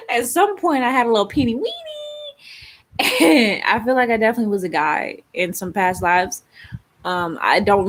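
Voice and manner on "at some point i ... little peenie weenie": giggling through the words, high and slow; giggly high voice; laughing. Real, not acted. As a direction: laugh it out, half embarrassed.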